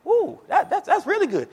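high-pitched mock cooing; impressed customer. Preacher imitating customers admiring the okra, high impressed cooing.

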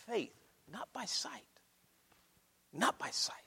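very soft, near-whispered point; quiet, paused and weighty; hushed emphasis. Real, not acted. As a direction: drop almost to a whisper. Preacher drops to a hush.

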